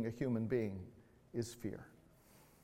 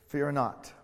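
normal talking voice

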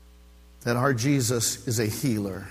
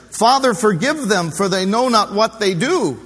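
higher, louder, pleading plea; loud pleading prayer; 6 semitones higher, 6 dB louder than usual. Acted. Preacher voicing Jesus forgiving the jeering crowd, loud pleading. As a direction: lift it as a fervent cry for mercy. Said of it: "his expression".

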